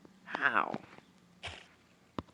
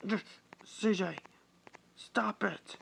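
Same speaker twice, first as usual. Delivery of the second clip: desperate plea; 10 semitones higher, slower than usual. Acted. Wounded Alexis begging CJ to stop, high voice. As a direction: beg him to stop.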